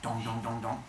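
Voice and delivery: low, soft dong notes; imitated strumming. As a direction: voice the low strings being plucked. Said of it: "he starts to strum it".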